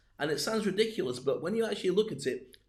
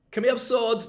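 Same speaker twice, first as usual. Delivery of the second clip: higher, louder pleading ask; whiny team member; 7 semitones higher, 7 dB louder than usual. Pretend. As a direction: beg like a cheeky caveman recruit. Team member asks leader.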